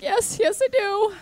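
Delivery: high, bright mock eager yes. Casting director accepting a hug, eager high yes. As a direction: squeal yes like she badly wants the hug. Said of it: "And she's like".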